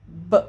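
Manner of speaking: B said as its bare sound; short, punchy B sound; letter B sound. Shown as a demonstration. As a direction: voice just the sound of B, not its name. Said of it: "ela faz esse som aqui".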